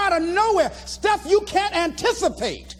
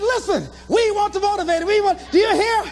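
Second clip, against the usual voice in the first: high, eager imitation of a chant; excited boasting; 6 semitones higher than usual. Man telling girlfriend about the crowd, excited. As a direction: brag by chanting it down the phone.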